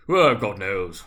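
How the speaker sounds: a loud, lazy drawl; yawning fat man. A narrator's reading. Fat merchant yawning to the group, loud and lazy. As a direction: let it out like a yawn. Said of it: "said the first with a yawn".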